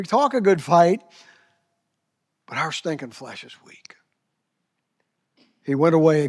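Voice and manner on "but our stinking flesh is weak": hushed confession; whispered aside to the congregation. Real, not acted. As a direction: drop to a whisper.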